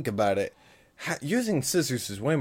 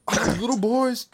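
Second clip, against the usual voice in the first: higher mock Dwayne The Rock voice; Rock impression; 11 semitones higher than usual. Joking with friend, doing a mock Rock voice. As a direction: do a jokey Rock impression, pitched up. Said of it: "That's my Rock impression".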